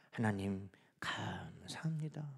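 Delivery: low, hushed confession to God; very soft, slow prayer; whispered thanks. Acted. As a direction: breathe it out slowly in a whisper.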